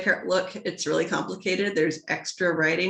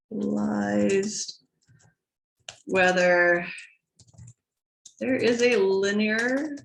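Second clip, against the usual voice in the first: slow dictation; much slower than usual. Acted. Dictating while typing.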